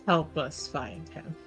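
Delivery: usual speaking voice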